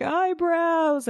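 high, whiny imitation of a sobbing kid; imitating the crying son; mock crying wail. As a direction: wail it like a heartbroken child. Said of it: "Brian is just crying the whole time".

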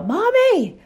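put-on high, loud little child yelling for parents; kids yelling. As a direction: call out like a toddler stuck in a room. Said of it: "they yell".

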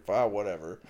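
low, unimpressed brush-off; imitated dismissive wife. Imitating wife hearing about yelling at YouTube, dismissively. Retelling wife's unimpressed reply.

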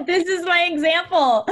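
giggling admission; laughing. Real, not acted. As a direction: laugh through the line. Host laughs at her own example.

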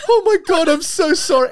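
very high, loud, laughing "Oh my God"; laughing mock apology. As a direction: squeal a horrified apology through laughter. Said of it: "And he's like".

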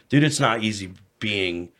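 ordinary talk, nothing marked

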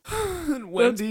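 high, drawn-out tearful wail; sob-choked high voice; crying. Acted. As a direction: wail it slowly through tears. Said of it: "I think [name]'s crying".

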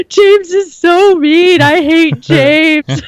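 high, drawn-out hammed-up wail; mock crying. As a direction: bawl it slowly like an overdramatic tantrum. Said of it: "just start crying and be like".

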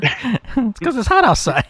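high, slow mock dad excuse; lightly hammed-up father explaining. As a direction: drag it out like a grumpy dad. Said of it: "dad's excuse".

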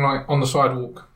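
ordinary talk, nothing marked

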